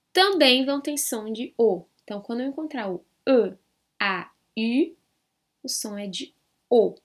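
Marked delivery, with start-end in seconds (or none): 5.6-5.7 "O" said as a closed, rounded oh; closed O sound. Shown as a demonstration. Teacher giving the three-vowel sound to learners, closed O. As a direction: keep the "O" tight and closed. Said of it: "Fechadinho também".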